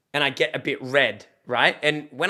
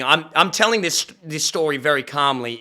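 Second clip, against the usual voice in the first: ordinary talk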